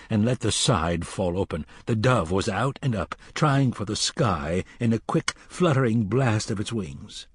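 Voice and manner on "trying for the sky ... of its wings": ordinary delivery, nothing marked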